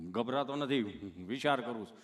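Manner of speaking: low, halting stammerer; tongue-tied Gabho. Retold or imitated. Gabho telling the nurse he's thinking, low stammer. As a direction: stick before each phrase, pitched low. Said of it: "ચોંટે અટકે".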